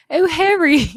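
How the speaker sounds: put-on high, loud British accent; Hermione. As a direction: put on a hammed-up British girl's voice. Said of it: "try to mimic Her-, Hermione".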